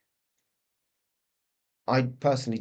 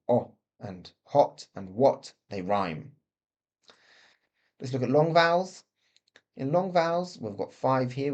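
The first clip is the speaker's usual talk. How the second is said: ordinary talk, nothing marked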